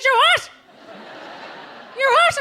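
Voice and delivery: high, loud disbelief; shocked. Retold or imitated. Dee hearing her cleaner is leaving, shocked and loud. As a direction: shriek it in disbelief.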